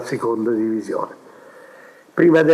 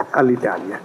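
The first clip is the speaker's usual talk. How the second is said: ordinary talk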